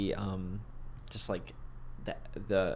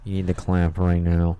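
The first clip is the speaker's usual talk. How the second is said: strict teacher; 4 semitones lower, 6 dB louder than usual. Retold or imitated. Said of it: "so that the teacher doesn't scream".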